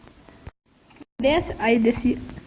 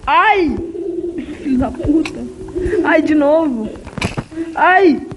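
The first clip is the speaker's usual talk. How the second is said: loud, high yelps of pain; 3 semitones higher, 4 dB louder, slower than usual. Real, not acted. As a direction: cry out at each hit. Getting hit in fight.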